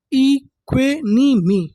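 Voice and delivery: slow, separated "ई क्वे नी मी"; "ई क्वे नी मी" drawn out with gaps between syllables. Shown as a demonstration. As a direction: sound out "ई क्वे नी मी" one piece at a time. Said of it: "एक एक अक्षर को पढ़ना".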